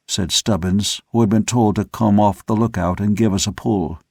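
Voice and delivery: ordinary delivery, nothing marked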